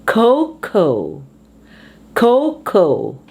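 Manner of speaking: "Cocoa" as KOH-koh; first-syllable stress. Shown as a demonstration. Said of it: "on the first syllable".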